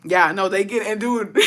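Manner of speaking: ordinary delivery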